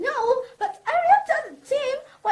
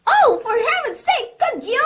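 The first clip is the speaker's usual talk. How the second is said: fed-up wife losing patience on the phone; exasperated outburst; 3 semitones higher, 8 dB louder than usual. Acted. Exasperated caller snapping at operator.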